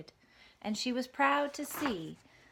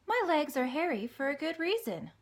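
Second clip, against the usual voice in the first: higher, patient spider voice; Charlotte the spider; 7 semitones higher than usual. Acted. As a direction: gently lecture as the wise spider. Charlotte's voice in story.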